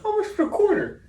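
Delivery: high laughing squeak; Gary Vee impression. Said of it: "he was like".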